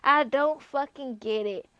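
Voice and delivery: normal talking voice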